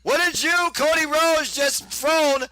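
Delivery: high-pitched, excited ringside call; excited play-by-play. Acted. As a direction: call it like a live wrestling announcer.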